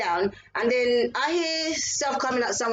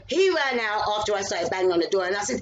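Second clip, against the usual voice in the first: normal talking voice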